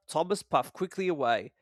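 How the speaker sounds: usual speaking voice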